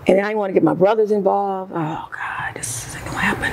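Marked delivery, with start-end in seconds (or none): 1.7-3.5 hushed unvoiced whisper; scared inner whisper; whispered thought. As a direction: whisper it to yourself in dread. Inner thought while hiding situation.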